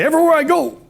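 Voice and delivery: high-pitched orator's impression, city by city; Doctor McFarland. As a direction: imitate a grand old-school speaker. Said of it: "the way he talked, he'd say".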